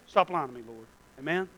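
ordinary talk, nothing marked

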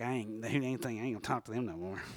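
put-on a quick, low-key old-school cutoff; old-school brush-off. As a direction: dismiss them quickly under your breath. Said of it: "You just say stuff like".

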